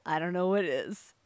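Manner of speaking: ordinary delivery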